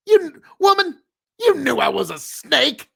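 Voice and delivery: a loud, high, sneering snake voice; cold snake. Acted. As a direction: speak as the snake, smug, exposing her. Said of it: "the snake goes".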